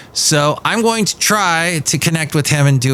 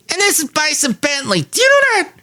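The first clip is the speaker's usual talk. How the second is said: high cartoon voice. Bison Bentley character greeting Mike, squeaky. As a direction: pipe up like a cartoon critter. Second cartoon guest introduced.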